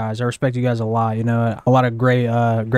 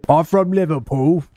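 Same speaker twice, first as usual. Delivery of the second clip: put-on Liverpool accent; 4 semitones higher than usual. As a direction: attempt at a Scouse accent. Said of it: "Don't sound like you are".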